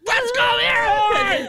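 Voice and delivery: very high, shouted hype cry; excited cheer. Real, not acted. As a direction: shriek it like a fan cheering someone on. Host cheers the guest on.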